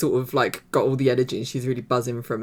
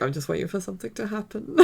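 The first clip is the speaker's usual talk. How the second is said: higher, softer mock-anxious voice; imitated edginess; 7 semitones higher, 5 dB quieter, faster than usual. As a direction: mimic someone waiting on edge. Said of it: "Kev seems really on edge".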